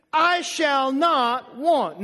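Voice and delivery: drawn-out "I shall not want" for the church to echo; slow call to repeat. Shown as a demonstration. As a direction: lead the congregation slowly. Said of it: "Say that with me".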